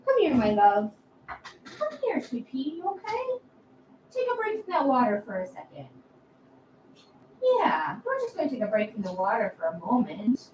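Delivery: soft, tender coaxing of a cat; soothing pet talk. Real, not acted. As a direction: hush and comfort the little one.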